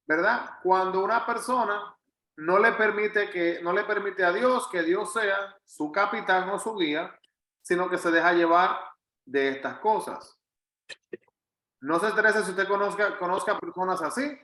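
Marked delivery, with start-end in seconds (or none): none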